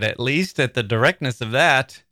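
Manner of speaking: normal talking voice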